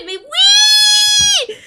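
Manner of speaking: high, loud shrieks of sudden realization; a fan's delighted shout; ecstatic shouting. Real, not acted. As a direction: scream it out, overwhelmed with joy. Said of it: "je suis tellement excitée".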